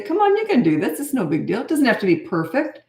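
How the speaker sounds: lightly voiced supportive parent at homework; encouraging coach voice. Shown as a demonstration. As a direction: cheer the child on gently.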